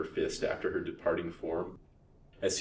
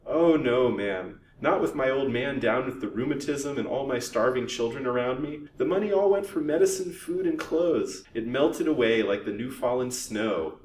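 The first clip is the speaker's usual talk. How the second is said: put-on higher, piteous old crone whine; whining; 6 semitones higher, 5 dB louder than usual. A narrator's reading. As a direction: plead poverty in a whine. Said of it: "whined Haidee".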